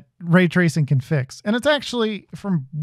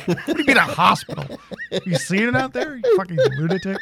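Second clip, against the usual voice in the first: laughing mock outrage; 2 semitones higher than usual.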